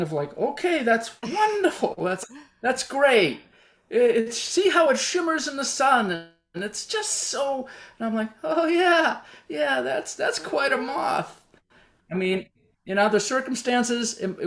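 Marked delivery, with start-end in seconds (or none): 0.4-11.4 high, strained nervous politeness; forced enthusiasm. Retold or imitated. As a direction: fake delight to hide fear.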